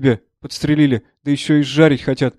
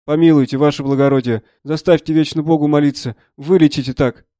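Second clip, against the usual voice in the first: loud, urgent entreaty; pleading soldier; 6 dB louder than usual. Acted. Soldier begging the doctor to spare his leg, pleading. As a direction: beg a superior for mercy.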